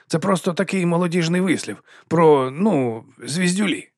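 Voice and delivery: high-pitched, halting explanation; apologetic interjection. A narrator's reading. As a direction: explain it up high, groping for the word. Wizard cuts in to explain.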